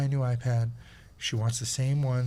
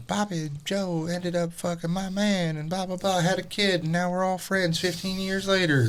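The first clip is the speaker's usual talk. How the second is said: Southern gossip; 6 semitones higher than usual. Retold or imitated. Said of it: "cheating and scandal shit that the South is known for".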